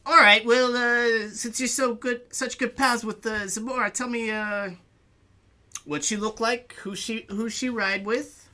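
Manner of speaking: high, loud testing question; probing orc bouncer. Acted. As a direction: quiz them, suspicious and loud. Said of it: "He's like".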